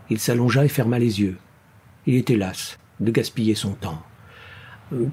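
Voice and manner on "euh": ordinary delivery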